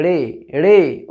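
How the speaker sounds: रे said very slowly and clearly, not अड़े; slow model pronunciation; correct pronunciation. Shown as a demonstration. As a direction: drag out रे, रे with no vowel before it. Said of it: "अड़े नहीं बोलना है".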